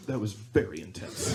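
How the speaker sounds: low, understated comment after the scare; unscared reaction. Retold or imitated. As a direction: react mildly, dropping the pitch. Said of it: "he's like".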